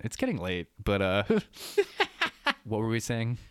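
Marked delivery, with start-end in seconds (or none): 1.3-2.6 high laugh. Real, not acted. Laughing at own impression.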